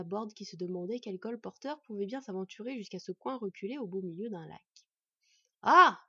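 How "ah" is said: very loud, higher yelp of alarm; startled cry. A narrator's reading. Dwarf spotting the boy, startled loud cry. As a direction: recoil with a sudden cry. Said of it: "s'écria Nuri avec un mouvement de recul".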